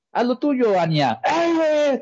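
high, drawn-out shouted cheer; high slow shouted encouragement. Retold or imitated. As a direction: stretch it out as a rally cry. Imitating Bart-style cheer.

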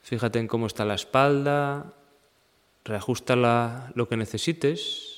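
even, unhurried meditation guide; guided meditation. Acted. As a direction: guide them through the body in level phrases with long pauses. Leads a seated meditation practice. (meditación guiada, pausada y serena)

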